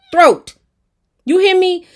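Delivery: loud, high outburst on "throat"; outraged exclamation. Real, not acted. Viewer outraged that Lou choked Will, loud and high. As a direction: shout it, furious at what he did.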